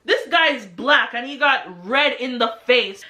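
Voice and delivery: ordinary talk, nothing marked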